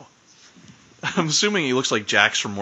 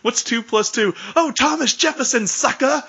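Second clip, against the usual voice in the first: much higher mocking wrestler promo voice; the Rock impression; 12 semitones higher than usual. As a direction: imitate a wrestling star taunting a dumb opponent, pitched way up. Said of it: "I still love the Rock promos".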